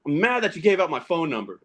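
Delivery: normal talking voice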